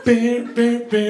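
voiced music imitation; beatbox the tune; mimicked beat.

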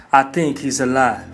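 usual speaking voice

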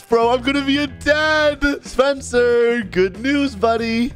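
high-pitched happy news; thrilled new dad, voice lifted high; excited announcement. Acted. Announcing the adoption to Spencer.

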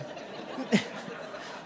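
a quiet laugh at himself while telling; amused little laugh; soft laugh. Real, not acted. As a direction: chuckle as the story slips away. Teller laughs at his own story.

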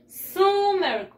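"Summer" sounded as SOO-mer, drawn out; spelling pronunciation. Shown as a demonstration. Teacher spells it for pupils, "Summer" as SOO-mer. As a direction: say "Summer" as spelt, with a long oo. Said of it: "Se escribe así".

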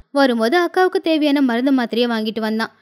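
ordinary delivery, nothing marked

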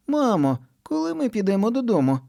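put-on high little-girl voice; small child asking mom. A narrator's reading. Kitty asks mom in high child voice. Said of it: "питає вона".

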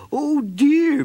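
high, fretful snail voice; fussing Mrs. Snail. A narrator's reading. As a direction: fuss over the party like a worried mother. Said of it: "Missus Snail fussed".

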